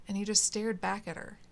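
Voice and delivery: plain reading voice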